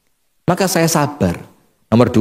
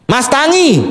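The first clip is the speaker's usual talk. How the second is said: bossy wife; 7 semitones higher than usual. Acted. Wife ordering her husband to get up, high and commanding. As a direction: bark the wake-up call.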